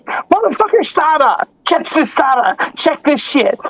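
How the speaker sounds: a hint of a buddy showing off his stash; imitating friend. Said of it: "He said".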